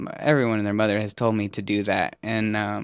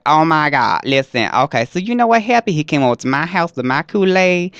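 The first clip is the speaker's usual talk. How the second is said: loud, sassy gossiping friend voice, pitched up on the question; Peaches character; 4 semitones higher, 5 dB louder than usual. Acted. Comedian voices his friend Peaches, loud and sassy. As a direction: dish the gossip, big and loud.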